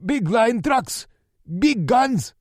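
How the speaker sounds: high, loud, slow stumbling cry; stumbling panicked yell. A narrator's reading. Captive stumbling out answers to Lou. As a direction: trip over the words in fear. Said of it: "He stumbled over his words".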